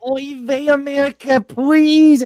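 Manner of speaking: high pleading Yiddish voice; Yiddish accent. Retold or imitated. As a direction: beg loudly in a mock Yiddish accent. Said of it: "they were like".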